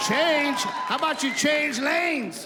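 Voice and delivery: loud sneering shout, stretched slow; mocking rage. Stuck behind a slow Prius, shouting slow and sneering. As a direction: bellow the comeback slowly.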